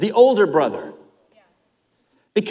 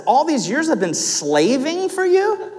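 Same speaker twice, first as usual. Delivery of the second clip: disbelieving question rising at the end on "you"; incredulous echo; 10 semitones higher than usual. Acted. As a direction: repeat his words back in disbelief. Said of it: "think about how cold-hearted that is".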